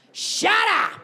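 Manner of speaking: high, loud snap; shout. Acted. To people with lockdown opinions, loud high shout. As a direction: shout them down. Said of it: "calling out".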